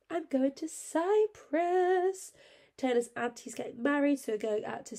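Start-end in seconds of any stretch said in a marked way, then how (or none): 0.1-2.3 excited. Real, not acted. Excited about holiday.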